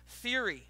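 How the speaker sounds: THEORY said alone, deliberately; stress on THEORY. Shown as a demonstration. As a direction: set THEORY apart as a key term. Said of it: "underline the word theory".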